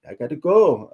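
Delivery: quick mock British accent for his exit line. Imitating Idris leaving in a British accent. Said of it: "in that British accent".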